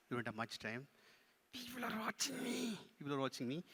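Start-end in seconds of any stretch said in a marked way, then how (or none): 1.5-2.8 high, soft puppet voice; small high puppet showing off; self-important puppet. Pretend. Puppet speaks, ventriloquist echoes.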